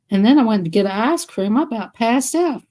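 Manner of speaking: normal talking voice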